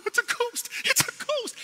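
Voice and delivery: very high, panicked mock cry; frightened disciples squealing in a storm; terrified disciples. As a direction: shriek it like grown men losing it. They're screaming, crying.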